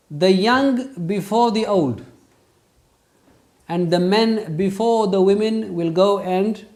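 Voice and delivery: loud, high, slow proclamation; quoting his shaykh. Retold or imitated. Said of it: "He used to say, not in his accent".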